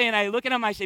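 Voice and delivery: usual speaking voice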